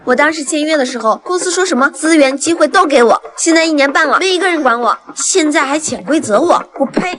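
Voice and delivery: fed-up, loud accusation; angry outburst. Acted. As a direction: let rip at the manager who cheated you. Artist angrily confronts manager over contract.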